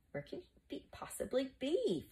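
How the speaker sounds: high, hushed wondering. A narrator's reading. Flavius looks for the missing schoolmaster, wondering. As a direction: wonder aloud, lightly. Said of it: "Flavius wondered".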